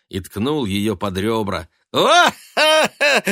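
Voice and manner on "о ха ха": high, loud silly laugh; idiotic guffaw. A narrator's reading. Son laughing at his worried mother, idiotic guffaw. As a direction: burst out in a goofy ha-ha. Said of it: "Он идиотски расхохотался".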